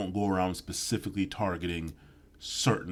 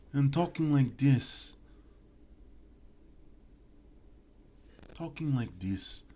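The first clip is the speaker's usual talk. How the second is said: slow, higher hammed-up Italian accent; mock Italian talk; 6 semitones higher, slower than usual. Mimicking how Italians talk, lightly hammed. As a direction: drag it out in a cartoon Italian voice.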